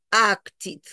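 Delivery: slow "acted" ending in "tid". Shown as a demonstration. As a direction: say "acted" in two parts, ak-tid. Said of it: "cambia sonido por I".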